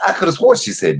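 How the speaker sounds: ordinary talk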